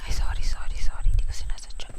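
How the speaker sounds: whispering an apology; whispered sorry. Real, not acted. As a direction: breathe the apology out. Apologizing softly mid-game.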